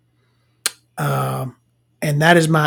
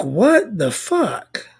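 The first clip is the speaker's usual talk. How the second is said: slow, stretched-out incredulity, pitched up; baffled disbelief; 4 semitones higher, 3 dB quieter, much slower than usual. Retold or imitated. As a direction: drag the words out in bewilderment. Retold bewildered reaction.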